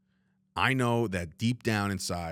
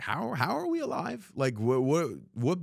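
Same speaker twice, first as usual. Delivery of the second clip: stunned disbelief; 6 semitones higher than usual. Retold or imitated. Texting friend in disbelief.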